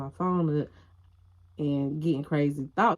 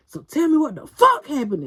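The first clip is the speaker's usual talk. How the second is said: high, loud furious demand; retold angry demand; 9 semitones higher, 6 dB louder than usual. Boyfriend demanding she explain, furious and loud.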